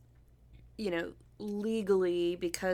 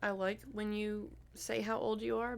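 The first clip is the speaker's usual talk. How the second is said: normal talking voice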